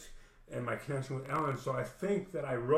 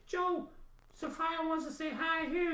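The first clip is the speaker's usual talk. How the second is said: put-on high, bright little-girl voice, much higher; imitating the niece. Niece announcing a friend on video chat, in a high child voice. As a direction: chirp it like a small girl holding up a phone. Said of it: "and she says".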